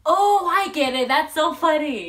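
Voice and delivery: high, loud and slow burst of getting the joke; slowly dawning, then thrilled; retelling a star who finally gets the joke; delighted realization. Retold or imitated. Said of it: "And he's like".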